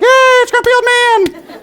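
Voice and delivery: drawn-out, very high mock squeal; cheering kids. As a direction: shriek with joy like excited schoolchildren. Imitated kids cheering for old man.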